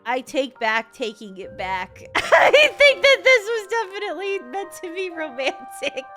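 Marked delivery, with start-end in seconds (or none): 2.1-6.0 high, loud, laughing squeal; giddy laughing. Real, not acted. Player conceding the scene was meant romantic, laughing giddily. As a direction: laugh helplessly through the whole admission.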